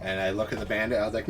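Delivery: ordinary delivery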